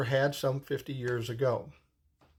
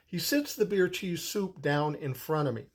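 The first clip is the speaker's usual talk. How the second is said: ordinary talk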